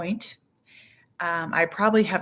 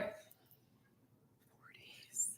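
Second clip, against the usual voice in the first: panicked whisper, barely voiced; stunned, hushed whisper; retold panic; 23 dB quieter than usual. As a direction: gasp it under your breath in mock panic. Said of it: "I sort of had a panic attack".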